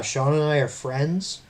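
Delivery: ordinary talk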